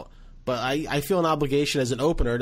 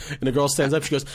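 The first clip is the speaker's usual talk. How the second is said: ordinary delivery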